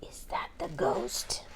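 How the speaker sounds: high, soft unvoiced whisper; scared whisper. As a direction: whisper it fearing the ghost. Frightened whisper in the dark.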